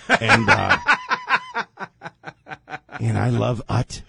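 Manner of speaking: hammed-up politician, pausing between phrases. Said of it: "He sent some poem about Ukraine to [name]".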